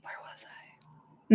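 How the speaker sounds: unvoiced, slow aside; slow whispered aside as the reader looks for the page; whisper to self. Real, not acted. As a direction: whisper it to yourself while finding your place. Reader loses place, mutters aside.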